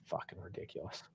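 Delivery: whispered through disgust, no voice at all; real whispered disgust. Real, not acted. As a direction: breathe it out in disbelief. Disgusted at the referee's call.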